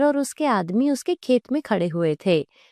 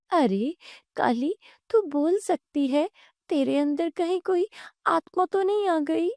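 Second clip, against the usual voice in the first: high, wondering and scared; startled fear; 8 semitones higher than usual. Acted. Woman asking the talking cow about spirits, frightened. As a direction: climb higher in acted fright. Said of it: "तीनों डर जाते हैं".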